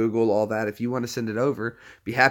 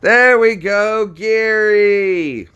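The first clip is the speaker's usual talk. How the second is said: high, loud, slow drawn-out welcome; excited greeting; 9 semitones higher, 9 dB louder, much slower than usual. Real, not acted. Streamer greets caller who finally connects, high and loud. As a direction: stretch it out, thrilled he finally got through.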